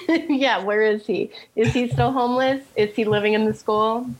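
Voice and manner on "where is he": ordinary talk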